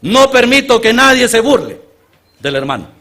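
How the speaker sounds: loud, high military command; officer forbids the troops to mock the brother; shouted order. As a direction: bark it as an order to the whole battalion. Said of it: "da una orden inmediatamente".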